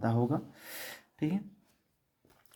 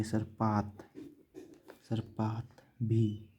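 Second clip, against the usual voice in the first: slow dictation; 4 dB quieter, much slower than usual. Shown as a demonstration. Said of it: "teacher dictates word slowly".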